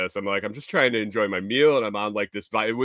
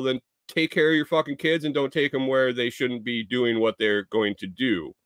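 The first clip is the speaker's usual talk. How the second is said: ordinary talk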